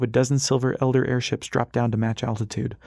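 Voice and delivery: usual speaking voice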